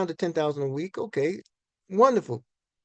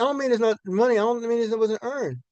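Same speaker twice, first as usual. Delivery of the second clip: ordinary talk, nothing marked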